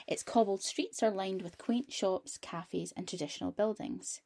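soft, even storytelling read; reading aloud. Acted. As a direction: read it like a documentary narration. Reads prepared history text.

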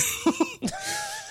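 squeaky burst of laughter; high squealing laugh. Real, not acted. Laughing at a friend.

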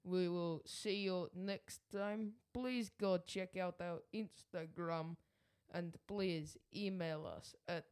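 put-on slow, deliberate Russian-accented sign-off. Co-host reluctantly saying goodbye to listeners, Russian accent. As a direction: plod through it in a Russian accent. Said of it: "Give me your Russian accent".

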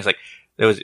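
ordinary talk

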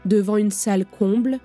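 ordinary delivery

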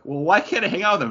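put-on high, fast, pleading kid sidekick; lightly voiced teen whining to his mentor; whiny Robin. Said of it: "Robin's the one that's like".

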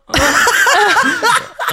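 squealing laugh; high burst of laughter. Real, not acted. S1 laughing while telling of the hotel night, high squealing laugh.

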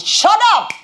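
high, loud shout of rebuke; sharp shout. Retold or imitated. Preacher shouting down the enemy's taunt. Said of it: "I said".

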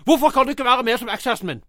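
high, loud, rapid nagging outburst; imitating angry yelling. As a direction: yell it like a fed-up girlfriend. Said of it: "Hun står bare og roper til han".

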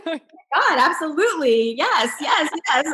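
high and excited, piling up the yeses; eager yes. Retold or imitated. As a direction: gush with eager delight. Eager acceptance of invitation.

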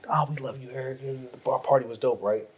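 usual speaking voice

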